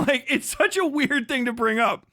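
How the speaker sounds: ordinary talk, nothing marked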